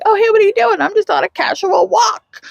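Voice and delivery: high, overly breezy small talk; feigned casual greeting. Retold or imitated. Nolan bumping into Sam outside, high fake-casual voice. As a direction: play innocent, pitched well up and a touch hammed-up.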